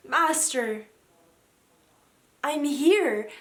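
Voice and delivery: loud, entranced cry to a master; sleepwalker's cry in full voice. Acted. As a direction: call out loudly, voice lifting. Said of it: "she cried out".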